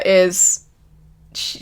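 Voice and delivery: ordinary talk, nothing marked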